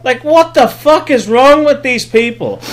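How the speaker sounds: baffled by these people; exasperated. Real, not acted. Ranting about the movie.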